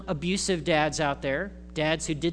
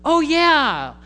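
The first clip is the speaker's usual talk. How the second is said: much higher and louder, mock realisation; knowing oh yeah; 10 semitones higher, 10 dB louder than usual. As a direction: act caught in the family spotlight. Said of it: "And you're like".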